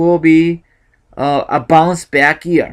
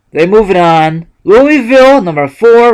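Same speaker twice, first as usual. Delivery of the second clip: excited call; 5 semitones higher, 8 dB louder than usual. Real, not acted. Announcing bracket winner.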